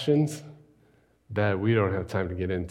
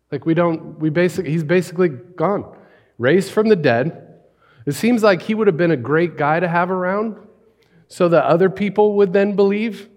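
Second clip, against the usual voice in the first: louder, higher, urgent preacher's questions; preaching at full force; 5 semitones higher, 6 dB louder than usual. Acted. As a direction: drive each question home from the pulpit. Passionate questioning in the sermon.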